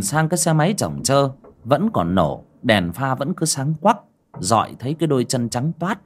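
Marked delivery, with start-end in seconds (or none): none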